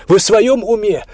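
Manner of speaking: loud, high, outraged question; indignant outburst. A narrator's reading. Olya from behind his back to police, indignant. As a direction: exclaim it, appalled and indignant. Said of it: "возмущённо воскликнула Оля".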